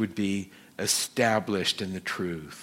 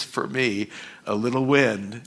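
ordinary talk

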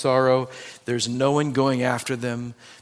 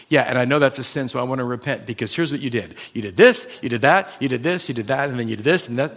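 rattled-off grievances; faster than usual. Retold or imitated. Retold bitter man listing grievances.

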